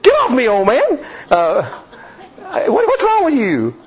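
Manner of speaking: high, put-on voice of a wife pushing him off; mock wife scolding her husband. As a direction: play the startled wife, pitched far up. Wife pushes husband away.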